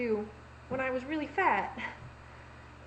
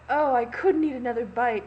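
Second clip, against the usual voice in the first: lightly mimicked mother; 2 semitones higher, 9 dB louder than usual. Imitating mom at a hot dog meal, mock protesting. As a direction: imitate mom declaring she is full.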